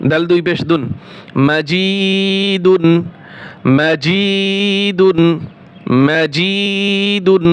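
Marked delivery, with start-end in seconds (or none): 1.5-7.5 মাজিদুন drawn out slowly; slow recitation model; slow model reading. Shown as a demonstration. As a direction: stretch মাজিদুন for students. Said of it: "teacher models the word slowly".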